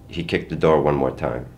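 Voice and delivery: plain reading voice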